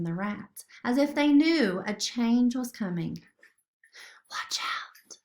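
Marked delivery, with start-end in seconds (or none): 4.3-5.2 unvoiced warning whisper; breathy urgent whisper. A narrator's reading. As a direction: hiss the warning under your breath. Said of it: "Sophia whispered".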